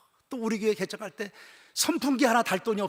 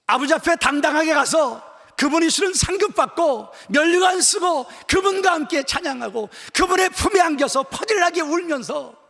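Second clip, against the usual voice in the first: loud, high, impassioned sermon; full-force preaching; 10 semitones higher, 10 dB louder than usual. Acted. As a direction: preach it out with fervour.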